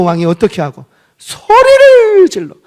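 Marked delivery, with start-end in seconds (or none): 1.2-2.5 very high, loud, stretched cry; drawn-out shout. Acted. Preacher showing how the king cried out, shouting. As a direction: yell it slowly, holding 소리를 long.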